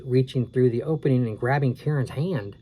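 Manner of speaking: plain reading voice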